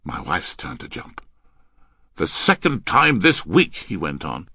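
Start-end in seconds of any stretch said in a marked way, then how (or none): 2.2-3.7 loud, raised complaint; exasperated rebuke. Acted. Man scolding the girl for repeated mistakes, exasperated. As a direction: pile on the blame loudly.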